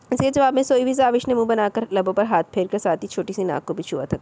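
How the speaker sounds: ordinary delivery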